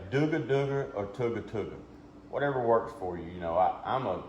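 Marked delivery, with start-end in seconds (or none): 0.1-0.9 "dooga dooga" rolled fast like a duck call feed; rolling feed call. Shown as a demonstration. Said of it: "you either say". 1.1-1.8 "tooga tooga" rolled fast like a duck call feed; rolling feed call. Shown as a demonstration. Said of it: "you either say".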